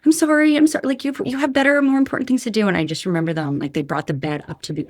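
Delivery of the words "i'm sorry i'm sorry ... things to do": high, loud, rushed sorry to the paramedics; apologizing over and over, voice way up and loud; frantic apology. Retold or imitated. Patient to paramedics, frantic loud apology. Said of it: "I was like".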